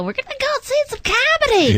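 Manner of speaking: mock a bland crowd; generic audience member. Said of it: "you know".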